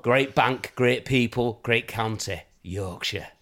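low, falling Yorkshire advert voiceover; Yorkshire accent. Acted. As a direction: sell the bank in broad Yorkshire. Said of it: "Lovely, Rob. absolutely nailed it".

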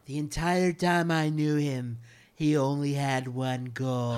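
mock trailer narrator.

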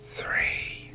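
breathy whispered number; whispering. A narrator's reading. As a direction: whisper it into the dark. Narrator whispers the chapter number.

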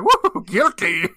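high, loud, slow laughing whoop; gleeful whoop. Retold or imitated. Imitating the delighted judge, laughing whoop. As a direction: whoop it with glee. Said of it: "He's all like".

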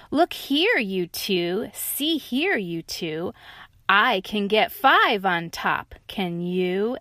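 excited tiger calling out; challenging tiger. Acted. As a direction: challenge the other two. Tiger calls out.

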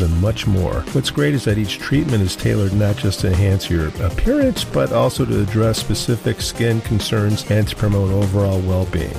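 smooth sponsor read. Acted. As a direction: sell the spa like a radio spot. Sponsor ad read over music.

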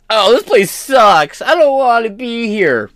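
high, loud whiny mock complaint; sneering imitation of a complainer; mock whine. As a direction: whine like a spoiled child. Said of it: "you start immediately going".

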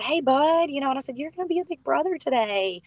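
put-on light and sweet, like announcing a happy surprise to a little boy; mother gently telling her toddler son he'll be a big brother, tender; tender hello to her son. Mother greets toddler son during labor.

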